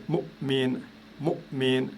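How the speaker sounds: mu'min with mu cut short by a throat catch; mu'min split by a silent gap; glottal stop demo. Shown as a demonstration. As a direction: close the glottis after mu in mu'min, then say min. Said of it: "Pareil ici".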